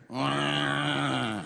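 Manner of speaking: imitated drawn-out baby wail; baby's wail. Comic mimics the crying baby in the theater, mock wail. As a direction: wail like a baby, held on one note. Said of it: "I hear".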